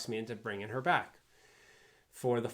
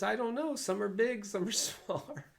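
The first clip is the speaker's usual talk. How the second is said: flustered chef; 11 semitones higher than usual. Retold or imitated. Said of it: "He says".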